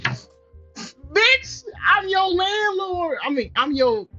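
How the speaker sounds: high-pitched, incredulous sass; indignant rant. Real, not acted. Landlord telling off a mouthy tenant, indignant rant. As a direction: pitch leaps up in outraged disbelief. Reader argues back as the landlord.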